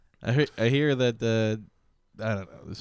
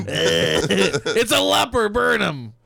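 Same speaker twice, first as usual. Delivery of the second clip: mock angry villager; 7 semitones higher, 3 dB louder, much slower than usual. Mock mob calling to burn leper.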